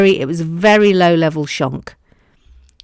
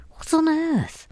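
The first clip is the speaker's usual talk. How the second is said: high squeaky what on earth; incredulous; 11 semitones higher, 6 dB quieter than usual. Real, not acted. Baffled at her own silly voice, squeaking. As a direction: squeak it in disbelief.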